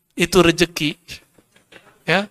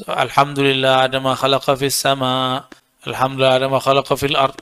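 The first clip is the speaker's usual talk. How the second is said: recited dhikr; 4 semitones lower than usual. Shown as a demonstration. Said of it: "Kita baca juga".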